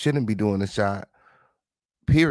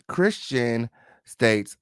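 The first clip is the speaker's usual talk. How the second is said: ordinary talk, nothing marked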